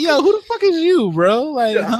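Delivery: squeaky-high comic outrage; hammed-up offended outburst; mock indignation. Acted challenge to a fussy guest.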